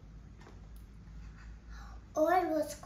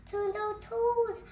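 ordinary delivery

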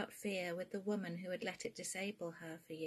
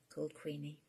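plain reading voice

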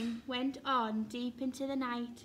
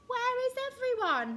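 high, loud, quick panicky wail; lost and panicking, pitch way up; 11 semitones higher, 5 dB louder, faster than usual. A narrator's reading. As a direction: cry out in dismay. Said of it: "wailed Duck".

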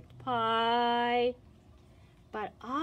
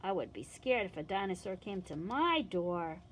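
plain reading voice

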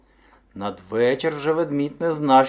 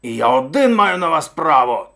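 loud, imperious bear claim; booming lordly declaration; bear shouting; 3 semitones higher, 8 dB louder than usual. A narrator's reading. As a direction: assert your rule loudly as a bear. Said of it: "кричить".

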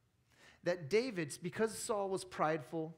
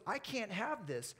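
higher-pitched, mock royal indignation; jealous pride; 6 semitones higher than usual. King hearing the crowds praise his rival, jealous and indignant. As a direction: act out a king's wounded pride. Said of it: "so he gets jealous of David".

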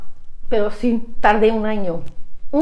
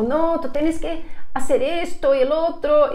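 high-pitched imitation of eager advisers; helpful coaching; 8 semitones higher than usual. Classmates trying to help with the R, high eager coaching. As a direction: instruct in a raised, lilting voice. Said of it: "me intentaban ayudar".

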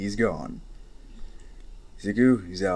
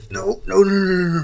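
louder, higher rapid no's; flustered denial; 9 semitones higher, 8 dB louder than usual. Acted. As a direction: hastily wave off the idea. Denki denies coming to fight.

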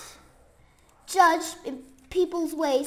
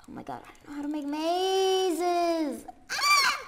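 panicked outburst; 5 semitones higher than usual. Real, not acted. Panicking while building.

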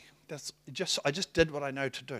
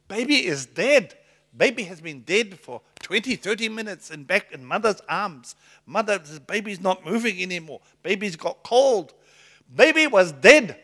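emphatic report; 4 semitones higher, 9 dB louder than usual. Retold or imitated. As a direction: hammer home that the baby is dead. Interpreter insisting baby dead.

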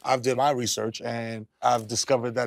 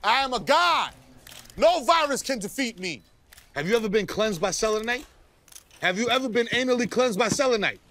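much higher, preachy and self-important; grandiose rant; 10 semitones higher than usual. Acted. Kyrie ranting to the reporters about masculinity, high preachy rant. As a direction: proclaim it like a prophet.

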